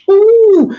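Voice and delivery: very high loud squeal of surprise; high delighted ooh at the news; excited ooh. Real, not acted. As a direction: shoot way up in delight. Reacting to news.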